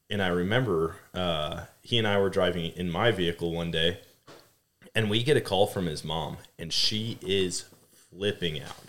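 ordinary talk, nothing marked

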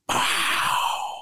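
a short breathy pop for the gun; mock gunshot blown through the lips; imitated gunshot pop. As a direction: puff out a gunshot sound. Retelling a gunshot going off.

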